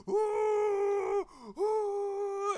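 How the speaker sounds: high, loud reenacted cries of pain; pained yelp. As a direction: yelp like the brush just hit raw flesh. Metal brushes scraping raw wound.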